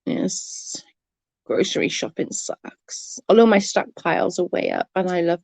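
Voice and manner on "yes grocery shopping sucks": unvoiced whisper aside. Real, not acted. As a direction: whisper it under your breath. Aside under breath.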